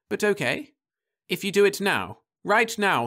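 put-on high, insistent young woman; Florence's voice. A narrator's reading. Wife demanding they marry at once, high and insistent. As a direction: press him in a high voice.

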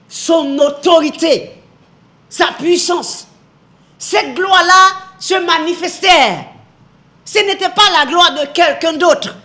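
loud, slow, swelling sermon build; preaching at full force. Acted. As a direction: hammer out each phrase to the congregation. (prédication enflammée, lente et forte)

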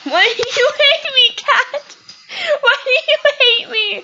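high, loud whine; play-crying plea; mock heartbroken wail. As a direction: wail at the cat, hammed-up. Mock pleading with cat.

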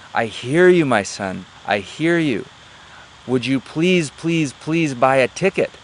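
loud, high, exasperated plea from a saint; saint statue. Acted. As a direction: act a statue answering, swooping up on each phrase. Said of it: "the statue moves and takes a little look and looks down at the man".

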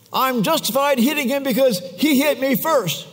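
put-on high, indignant child's voice; mock child defending himself, high-pitched; self-righteous kid. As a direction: justify yourself like a petulant kid. Kid's justification voiced.

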